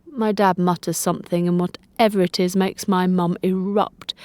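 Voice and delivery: plain reading voice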